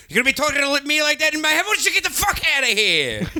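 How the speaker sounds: fast, high, barking umpire call; baseball umpire. Pretend. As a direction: bark it like an umpire calling a play. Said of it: "sound like a baseball umpire when I do that".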